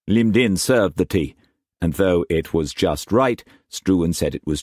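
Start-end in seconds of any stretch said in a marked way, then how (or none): none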